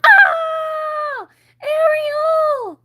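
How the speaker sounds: very high, loud scream; terrified scream. Acted. Flounder screaming for Ariel, high and loud. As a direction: scream in fright.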